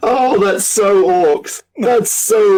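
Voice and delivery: high, drawn-out delight after laughing; cracked up and thrilled; delighted amusement. Real, not acted. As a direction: gush it slowly through real amusement. Cracking up at the orc joke.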